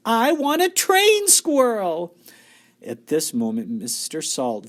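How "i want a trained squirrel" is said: high, loud, slow spoilt demand; bratty shout. A narrator's reading. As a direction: drag it out like a brat. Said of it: "Veruca shouted".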